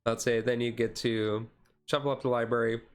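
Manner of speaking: usual speaking voice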